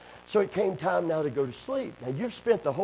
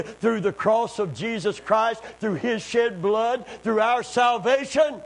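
building from calm to fervent proclamation; swelling sermon cadence; rising preaching; 4 semitones higher, 3 dB louder than usual. Acted. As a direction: climb toward a triumphant shout. Builds to triumphant declaration.

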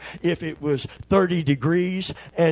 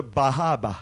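put-on Maine accent, dropping the r in Bahaba; 4 semitones lower, 3 dB quieter than usual. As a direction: say Bahaba with no r sounds, Maine style. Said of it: "They dropped the R's".

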